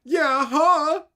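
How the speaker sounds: high, loud sneering "yeah, huh"; mocking retort. Mocking a free man of the land, high and loud. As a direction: throw it back in a mock dopey voice.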